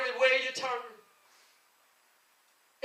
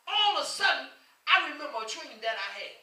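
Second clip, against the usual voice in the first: normal talking voice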